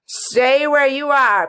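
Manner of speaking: slow, loud, high command; imperious, drawn-out command at the start. A narrator's reading. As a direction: order her sternly to halt.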